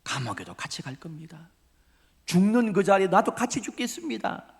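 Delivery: bold vow building to a high pitch; big talk. Acted. Peter pledging to die with his Lord, boastful. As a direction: start contained, then proclaim it grandly. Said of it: "그렇게 큰 소리 쳐놓고".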